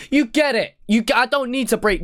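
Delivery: sudden high burst of delight; gleeful exclamation; excited. Real, not acted. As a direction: jump up, thrilled to get the pun. Reactor exclaiming over a clever bar.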